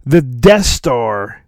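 ordinary talk, nothing marked

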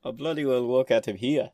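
mock Scottish accent through a big grin; grinning fanboy quote. Fan answering James Cosmo with a grin, mock Scottish accent. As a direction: deliver it beaming like a thrilled fan. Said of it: "with the biggest fanboy grin on my face".

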